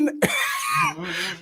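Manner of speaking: sudden high-pitched laugh burst. Real, not acted. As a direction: squeal with laughter. Laughs at Randy.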